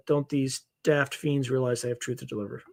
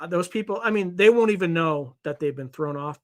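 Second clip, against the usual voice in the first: ordinary talk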